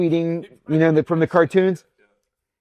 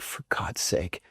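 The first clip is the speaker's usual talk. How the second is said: slow, much softer and lower; exasperated mutter; 6 semitones lower, 10 dB quieter, slower than usual. Retold or imitated. Husband hearing of his wife's accident, exasperated mutter. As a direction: mutter it under the breath, drawn out.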